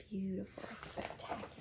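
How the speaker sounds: whispered in wonder; hushed, marveling whisper; awed whisper. Real, not acted. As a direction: breathe the words in awe.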